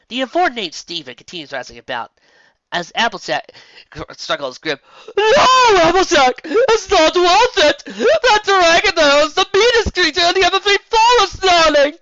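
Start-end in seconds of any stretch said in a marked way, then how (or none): 5.2-12.0 much higher, loud theatrical plea; dramatic Rarity cry. A narrator's reading. Rarity to Applejack, loud high theatrical plea. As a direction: beg like a grand lady.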